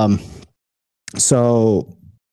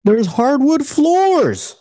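exasperated; much slower than usual. Real, not acted. Exasperated about the carpet.

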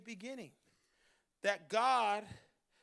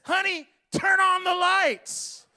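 mock wife calling out, slow and loud; wife's request; 8 semitones higher, 14 dB louder, slower than usual. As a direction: playfully imitate his wife's call. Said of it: "my wife says".

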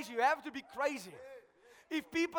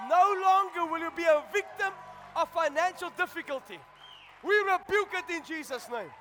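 full-force preaching; 3 semitones higher, 4 dB louder than usual. Acted. Preacher stirring the congregation, loud high shouting preaching. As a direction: preach it over the music to the church.

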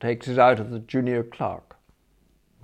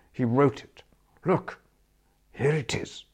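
confiding whisper; 4 semitones higher than usual. Acted. Spode confiding, showing notebook.

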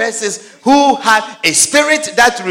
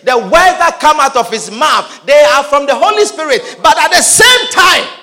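loud preaching; 6 semitones higher, 8 dB louder than usual. Acted. Preacher raises voice.

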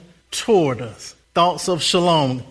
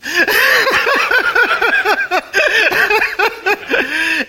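long loud high laughing fit; tickled laugh at the memory; long hearty laugh; 5 dB louder than usual. Real, not acted.

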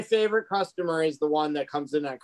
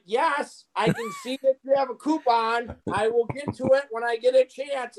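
higher-pitched, mock-patient retort; exasperated reply; 6 semitones higher than usual. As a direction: put off a pushy customer. Retold exasperated reply to pushy customers.

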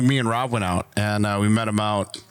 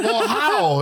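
incredulous; 10 semitones higher than usual. Retold or imitated. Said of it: "I'm like".

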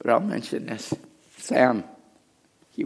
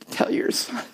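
high pleading challenge; 6 dB quieter than usual. Acted.

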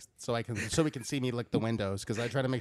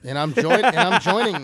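laughing; 6 semitones higher, 10 dB louder than usual. Real, not acted. Cracks up mid-phrase.

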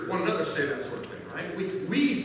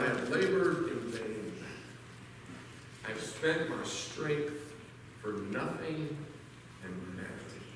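weary lament; 9 semitones lower, 9 dB quieter than usual. Acted. The servant confessing his wasted effort, hushed and weary. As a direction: let the words sink like a spent man's sigh.

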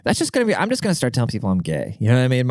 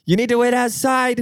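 high snippy receptionist; 13 semitones higher than usual. Retold or imitated. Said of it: "She's like".

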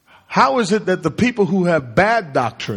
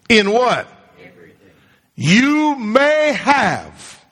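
preaching at full force; 4 semitones higher than usual. Acted. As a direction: call out to the congregation and pull them up.

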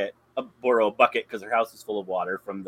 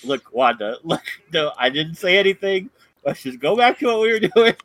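building from usual to high and loud; flustered denial; 6 semitones higher, 7 dB louder than usual. Retold or imitated. Geraldine backpedals to Wanda, rising flustered denial. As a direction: rush the backpedal, getting more frantic. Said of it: "She's like".

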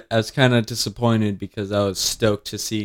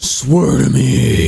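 slow, mock gruff hero voice; gravelly Batman; much slower than usual. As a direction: growl it like Batman. Batman quote joke.

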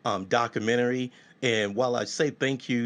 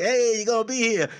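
eager high call; 12 semitones higher, 6 dB louder than usual. Retold or imitated. Chasing a guest with reminders.

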